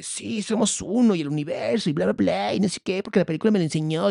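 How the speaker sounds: mock believer. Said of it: "Todos salimos así como de".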